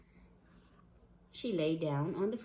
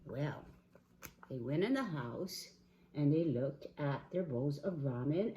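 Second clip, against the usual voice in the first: plain reading voice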